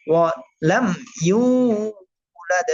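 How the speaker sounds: ওয়া, লাম, then drawn-out ইউলাদ; pauses between ওয়া and লাম, long ইউ in ইউলাদ; one part at a time. Shown as a demonstration. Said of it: "একটা একটা করে বলবেন".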